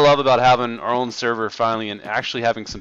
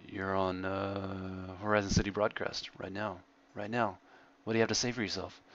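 much softer, a playful conspiratorial hush; hushed mock interview; 4 semitones lower, 11 dB quieter than usual. Hushed teasing of a sleeping-at-keyboard friend on the broadcast. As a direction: keep it down, teasing someone who can't hear you.

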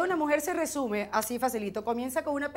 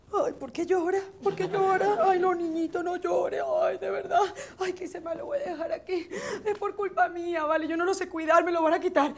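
anxious new mom; 5 semitones higher than usual. Acted. Anxious new mother voiced.

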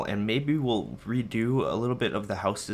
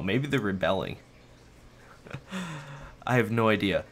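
normal talking voice

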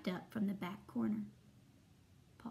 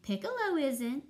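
high, louder lilting flute voice; singsong character voice, pitched up; trilling flute; 5 semitones higher, 5 dB louder than usual. A narrator's reading. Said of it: "trilled Flute".

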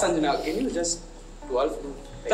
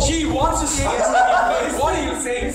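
laughing shout; 7 semitones higher, slower than usual. Real, not acted. Teasing the contestant, laughing.

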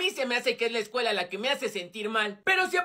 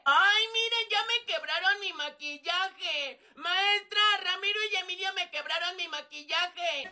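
whiny tattling; 5 semitones higher than usual. Acted. Regina telling teacher about broken makeup, whining. Girl tells on boys to teacher.

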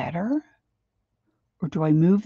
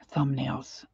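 whispered aside; 4 semitones lower, 8 dB quieter than usual. Real, not acted. Trailing whisper.

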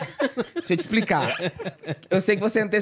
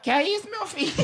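frightened mother; 10 semitones higher than usual. Retold or imitated. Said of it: "Ela que".

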